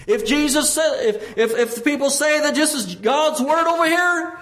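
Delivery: high, urgent pulpit delivery; insistent sermon cry; impassioned preaching. Real, not acted. Preaching at full force.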